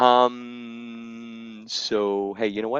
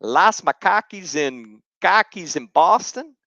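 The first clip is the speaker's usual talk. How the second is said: high, slow Boston accent; 6 semitones higher, 5 dB louder than usual. Shown as a demonstration. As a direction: drop the r's, thick Boston accent. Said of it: "I'm gonna do my best Boston impression".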